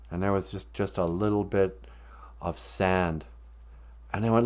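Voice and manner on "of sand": plain reading voice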